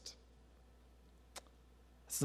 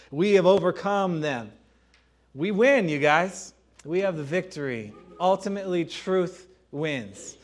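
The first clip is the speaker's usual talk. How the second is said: louder, higher victory cry; triumphant; 4 semitones higher, 5 dB louder than usual. Real, not acted. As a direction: proclaim the win to the congregation. Triumphant preaching climax.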